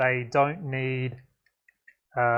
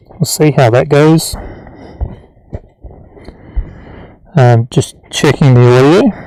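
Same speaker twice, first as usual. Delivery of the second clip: muffled inside a respirator mask, mic peaking; through a respirator; 18 dB louder than usual. Real, not acted. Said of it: "makes it so you hear me breathing more".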